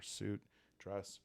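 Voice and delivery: usual speaking voice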